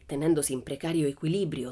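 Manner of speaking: usual speaking voice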